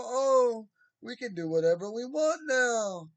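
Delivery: high, loud mocking voice of gleeful Saudis; mocking loosened-up Saudis. Mocking Saudis cheering that anything goes now. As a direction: lampoon them cheering their new freedom.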